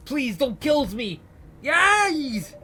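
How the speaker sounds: high, slow, screaming plea; a prisoner begging not to be shot; terrified scream. Acted. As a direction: shriek for your life.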